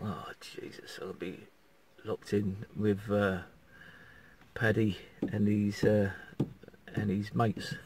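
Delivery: soft, slow, half-whispered dread; hushed worry. Retold or imitated. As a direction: murmur it like a private fear. Retold worried thought, hushed.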